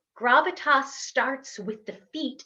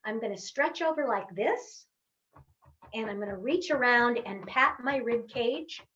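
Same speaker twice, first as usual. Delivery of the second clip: normal talking voice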